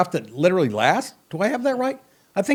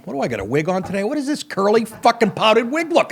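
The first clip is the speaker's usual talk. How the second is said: usual speaking voice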